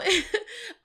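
high giggling laugh; burst of laughter. Real, not acted. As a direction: break into a laugh. Laughing at the quote.

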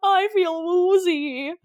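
high, slow, whimpering mock complaint; whiny man boy. Stu bleeding out, whining to Billy in a mock high whimper. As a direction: whine like a scared kid who got hurt. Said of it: "He's, like, crying and crying".

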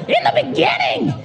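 Bible verse in a very high rock screech. Acted. Rock singer reading Genesis aloud, high screech. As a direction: screech it out, stretching the final words. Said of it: "What if they use that voice".

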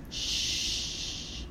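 long hushing shh with no voice; soft shush. Shown as a demonstration. Hushing listeners to show he wants quiet at home. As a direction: hush the room gently. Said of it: "I like quiet".

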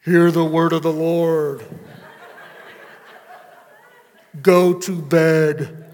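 very loud, slow booming proclamation with a laugh; hammed-up voice of God sending kids to bed; dad booms at kids like a prophet; mock preacher voice.

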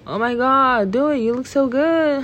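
high, gushing mock-girly voice; peer pressure voice. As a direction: hype someone up like a pushy friend. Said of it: "when they say".

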